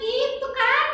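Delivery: put-on high, sing-song little girl voice; chirpy kid puppet answering the pastor; high child puppet voice. Tiur asks.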